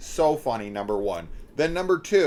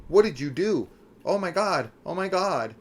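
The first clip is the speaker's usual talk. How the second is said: higher-pitched mock alarm; retold panicked reaction; 7 semitones higher than usual. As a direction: imitate her shocked cry, pitched up. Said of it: "she's, she's like".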